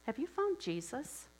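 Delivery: high, gentle questioning voice; kindly secretary's high inquiry; sweet Blanche. Acted. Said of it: "sweet Blanche asks Lucy".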